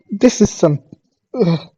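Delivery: ordinary delivery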